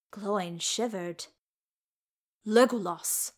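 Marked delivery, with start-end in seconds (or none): 2.4-3.3 calls the name sharply, louder. Acted. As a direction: snap him back to attention with his name. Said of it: "Gimli said, sharply now".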